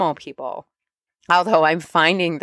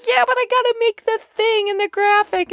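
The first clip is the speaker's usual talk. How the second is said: much higher, whining "yeah but"; whiny protest. Retold or imitated. Arguing with her spirit guides, high whiny protest. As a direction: protest like a stressed kid. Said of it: "And I'm like".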